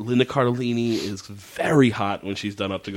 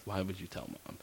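hushed; 5 semitones lower, 18 dB quieter, faster than usual. Retold or imitated. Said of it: "he's like".

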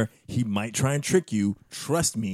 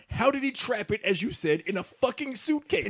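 incredulous; 11 semitones higher than usual. Real, not acted. Incredulous rant about the scene.

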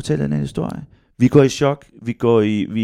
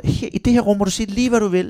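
higher-pitched reassuring voice; imitated therapist; 6 semitones higher than usual. Therapist inviting boy to speak freely, high and encouraging. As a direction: imitate a therapist giving full permission. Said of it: "Og terapeuten siger".

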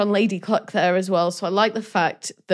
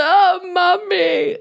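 put-on high, slow babyish whine; mock Prince John crying for mummy; hammed-up big baby; whiny baby prince; 11 semitones higher, 4 dB louder, much slower than usual. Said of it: "usurper king who's like".

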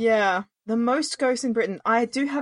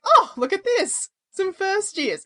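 mock ghost; 9 semitones higher, slower than usual. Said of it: "Just like".

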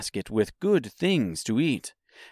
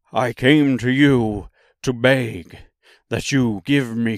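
slow, emphatic lion character voice; big, drawn-out voice of a cowardly beast; 4 dB louder than usual. Acted. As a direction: plead loudly and slowly as the lion.

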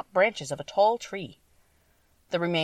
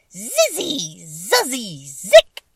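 high magic words ending in a piercing burst; loud cried incantation; 4 semitones higher, 9 dB louder, much slower than usual. A narrator's reading. Witch on both feet finishing her charm, loud high cry. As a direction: cry out the spell, exploding on the last word. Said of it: "cried in a loud voice".

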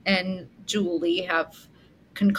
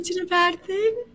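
mocking the impression; 9 semitones higher than usual.

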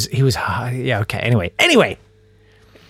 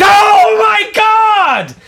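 drawn-out, very high scream; re-enacted full-pitch scream of horror; 7 dB louder, much slower than usual. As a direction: shriek it out in shock. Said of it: "And she screamed".

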